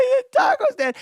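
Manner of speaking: high, loud crying wail; nine-year-old sobbing. Retold or imitated. Nine-year-old sobbing to his dad at the taco stand, crying. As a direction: wail it through tears like a kid. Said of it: "that nine-year-old sobbing".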